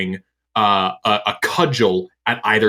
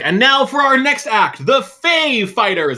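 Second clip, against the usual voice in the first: announcer; 14 semitones higher, 4 dB louder than usual. Acted. Announcer calling the next act up on stage.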